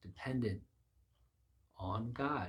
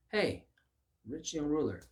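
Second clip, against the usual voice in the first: higher, louder hail; calling out; 6 semitones higher, 4 dB louder than usual. Retold or imitated. Preacher as Jesus hailing the young ruler, calling out. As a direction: call to him across the group.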